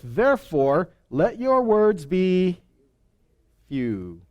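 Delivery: normal talking voice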